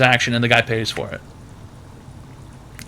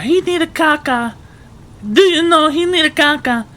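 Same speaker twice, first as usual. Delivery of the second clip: much higher, broken-English Eastern European granny voice; Eastern European granny accent; 13 semitones higher than usual. Retold or imitated. Grocery worker mimics a babushka asking for the toilet, high Eastern European accent. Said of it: "And she's like".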